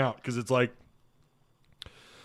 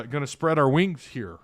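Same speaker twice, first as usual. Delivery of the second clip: ordinary talk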